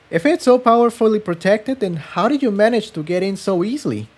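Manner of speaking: voiced young mare, a touch skeptical; curious girl voice. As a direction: pitch up to play the young companion asking. Tiptoe questioning Jigsaw.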